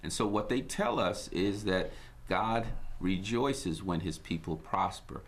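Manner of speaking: ordinary talk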